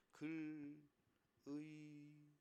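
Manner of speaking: soft, level, drawn-out syllables; mock syllable-by-syllable reading; exaggerated slow reading. Shown as a demonstration. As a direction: stretch each of "글, 의, 네" separately. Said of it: "이게 아니고".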